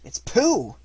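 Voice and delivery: excited. A narrator's reading. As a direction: burst out with happy recognition. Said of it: "said Chris Robin excitedly".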